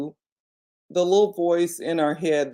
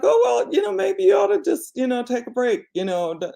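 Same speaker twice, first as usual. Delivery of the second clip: imitating her sister; 7 semitones higher than usual. Sister advising her to take a break, much higher mock voice. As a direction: play the sister brightly brushing it off with a suggestion. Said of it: "and she was like".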